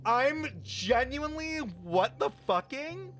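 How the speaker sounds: can't believe what's on screen; shocked disbelief. Real, not acted. Streamer shocked by empty screen.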